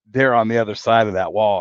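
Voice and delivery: ordinary talk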